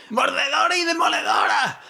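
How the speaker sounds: very high shriek; shrieking goblins. A narrator's reading. Goblins shriek at the swords, very high scream. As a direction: screech the sword names in terror.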